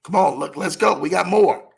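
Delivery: normal talking voice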